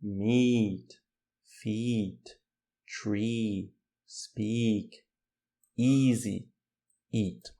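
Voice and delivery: "Meet, feet, tree, speak, easy, eat" each with a stretched "ee"; word by word, hold the "ee" in "Meet, feet, tree, speak, easy, eat"; long I sound. Shown as a demonstration. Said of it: "aquele I longo". ("Meet, feet, tree, speak, easy, eat" com i longo)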